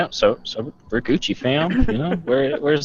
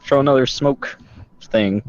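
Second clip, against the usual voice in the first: ordinary delivery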